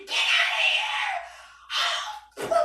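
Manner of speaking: strained, voiceless mock shout; imitated yell. Man yelling as the speaker steps closer, strained mock shout. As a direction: shout it hard with the voice giving out.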